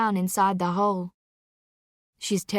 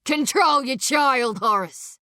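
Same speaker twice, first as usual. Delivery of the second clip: higher, louder angry order; shouting at brother; 5 semitones higher, 5 dB louder than usual. Acted. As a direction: bark it at the weeping brother. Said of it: "Aubrey shouts at his brother now".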